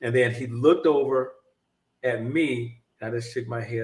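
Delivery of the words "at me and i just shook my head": ordinary delivery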